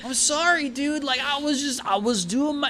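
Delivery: high-pitched, whiny excuse; pleading apology. Acted. Injured delivery driver apologizing to his boss, high pleading.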